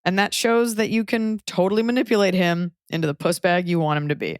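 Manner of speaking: ordinary talk, nothing marked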